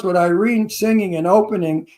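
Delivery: ordinary delivery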